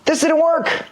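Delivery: put-on boy protesting to his granny; frustrated kid. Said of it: "Jimmy's like".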